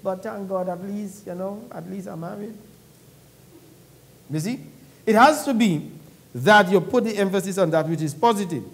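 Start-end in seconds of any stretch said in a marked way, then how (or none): 0.0-2.5 very soft passing afterthought; mumbled-down minor consolation, much quieter. Shown as a demonstration. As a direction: add it quietly as a side note. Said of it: "the by the way on the positive".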